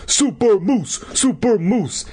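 high, triumphant cheer; bellowing. A narrator's reading. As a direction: bellow it like a self-declared champion. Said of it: "he bellowed".

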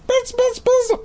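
very high, thin buzz; high buzzing bee. Acted. Bee sounds in a story.

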